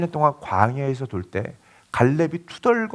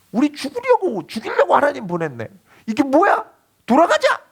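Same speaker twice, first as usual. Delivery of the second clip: wailing lament; 10 semitones higher than usual. Retold or imitated. Preacher voices the people lamenting at night, mock wailing. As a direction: cry out in despair, rising to a yell. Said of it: "탄식을 하고 울부짖습니다".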